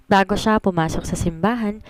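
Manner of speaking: ordinary delivery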